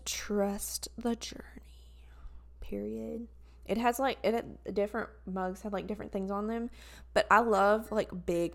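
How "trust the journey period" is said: slow soft whisper. Real, not acted. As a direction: whisper the mug's words slowly. Reading mug in whisper.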